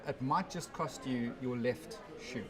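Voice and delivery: normal talking voice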